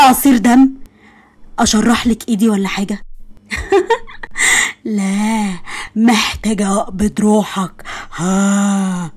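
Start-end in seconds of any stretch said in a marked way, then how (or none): 3.5-4.0 high mocking giggle. Mocking laugh. 4.8-9.1 slow mock menace. Menacing joke to boyfriend.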